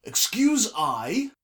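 loud, high, drawn-out pomposity; pompous interruption, pitched high and loud. A narrator's reading. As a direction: announce it with slow, ringing self-importance. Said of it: "came a pompous voice from behind her".

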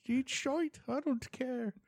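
put-on a light Irish accent. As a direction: curse the TV like an old Irishman. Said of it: "That's a good Irish accent".

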